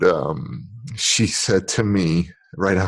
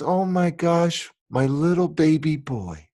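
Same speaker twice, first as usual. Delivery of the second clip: doting mother; 6 semitones higher than usual. Retold or imitated. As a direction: gush over her lost son. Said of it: "And she goes".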